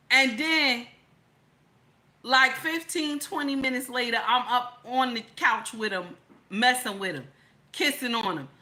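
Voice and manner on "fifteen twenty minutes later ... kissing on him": normal talking voice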